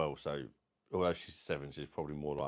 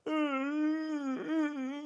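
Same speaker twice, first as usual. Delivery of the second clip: high wailing ooh; mock moaning. Teller mimicking man in wheelchair, high wail. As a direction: imitate a man's drawn-out moan.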